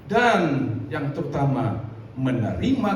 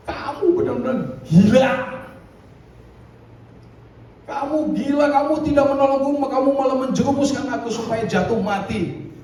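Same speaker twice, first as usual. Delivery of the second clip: high, louder, half-laughing scolding; a drunk ranting up at the voice; mock indignant drunk; 9 semitones higher, 4 dB louder than usual. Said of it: "Dia bilang". (pemabuk marah setengah tertawa)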